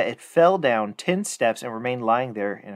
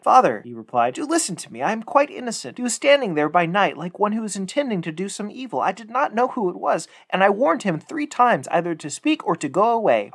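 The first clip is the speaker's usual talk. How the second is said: higher voice, earnest protest; pleading son; 7 semitones higher than usual. Acted. Son protesting innocence to his angry father, lightly higher.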